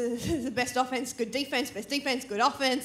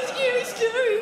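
high loud hype; hyped tease; 10 semitones higher, 5 dB louder than usual. Acted. Hyping the crowd for a scary story, loud and high. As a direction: whip up the crowd.

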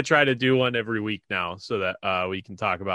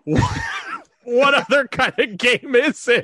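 incredulous; 13 semitones higher, 5 dB louder, slower than usual. Real, not acted. Mocking the announcer.

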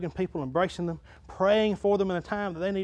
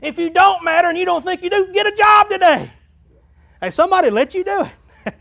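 loud, high-pitched revival preacher; full-force preaching; 12 dB louder than usual. Real, not acted. Pastor to church about everyone showing up, full-force preaching. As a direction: preach it out at the top of your voice.

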